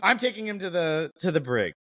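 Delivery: high, quick mock robot-officer quote; a high, playful put-on voice for the droid; lightly hammed-up high character voice; Kalani voice. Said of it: "Kalani just, like, he, he's like".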